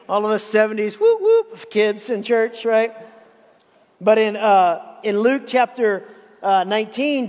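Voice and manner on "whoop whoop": high playful cheer; a quick excited cheer from the pulpit; playful whoop. Real, not acted. Cheering for seventies church kids.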